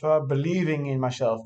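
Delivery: ordinary delivery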